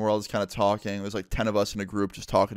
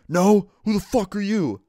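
high, loud imitation of an angry challenge; hostile shouting; 10 semitones higher, 7 dB louder than usual. Homeless man to a friend he doesn't recognize, imitated angry shout. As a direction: snap it loudly at an intruder.

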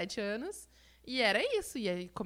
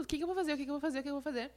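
higher, worried repeating to herself; anxious inner voice; 6 semitones higher, much faster than usual. Retold or imitated. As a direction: panicky self-talk, raised pitch. Retelling her panic. (voz aflita repetindo para si)

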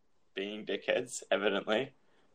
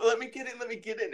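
mock frantic pleading; 12 semitones higher, faster than usual. Kid jumping about at the doorway, mock frantic pleading. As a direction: plead like a kid dodging past. Kid begging to get past parent.

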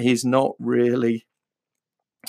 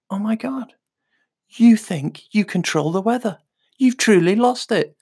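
put-on exasperated kid; 8 semitones higher than usual. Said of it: "he just went".

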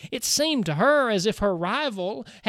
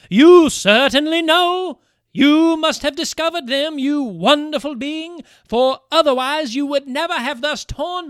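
loud, high, breathless pleading; pleading cry; 7 semitones higher, 10 dB louder than usual. Acted. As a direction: press her friend for the truth. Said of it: "cried the weeping Bertalda".

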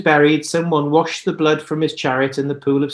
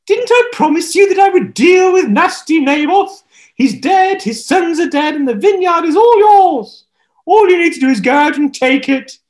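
very high, loud, cooing villain voice; sugary wife; 12 semitones higher, 8 dB louder than usual. Acted. Jezebel sweet-talking the king, high cooing. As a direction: coo at him like a scheming wife. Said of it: "Jezebel went straight to Ahab".

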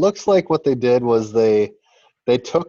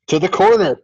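high, rising play-by-play calls; frantic mock sports commentary. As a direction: call the passes like a frantic announcer.

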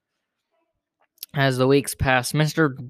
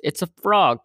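higher, mildly raised call; 5 semitones higher, 3 dB louder than usual. A narrator's reading. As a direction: pipe up with the news. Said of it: "called Alice".

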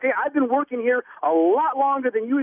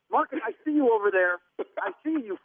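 ordinary delivery

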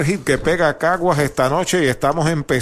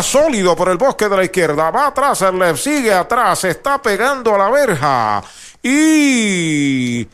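home run call; 6 semitones higher than usual. Real, not acted.

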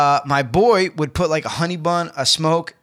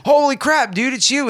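high-pitched, amazed recognition; retold surprised greeting; 7 semitones higher than usual. Inmate spotting his boy in lockdown, surprised. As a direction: re-enact the surprise, pitched well up.

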